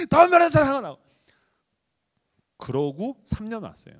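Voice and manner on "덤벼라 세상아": much higher, loud challenge; defiant cry. Retold or imitated. Pastor repeating his defiant cry to the world. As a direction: throw the challenge out.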